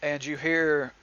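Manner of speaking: usual speaking voice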